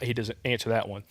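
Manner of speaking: ordinary talk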